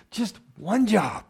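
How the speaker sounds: slow, drawn-out "just one job"; mock exasperation at kids who can't load a dishwasher; exasperated parent. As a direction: stretch it out with mock despair. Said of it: "It's like".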